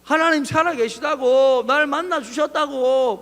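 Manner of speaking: high, loud, drawn-out protest. Acted. Believer arguing back at the mocking friend, loud insistence. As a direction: insist loudly, stretching it out. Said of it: "더 큰 소리로 이야기하죠".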